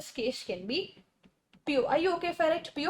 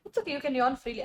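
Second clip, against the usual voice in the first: usual speaking voice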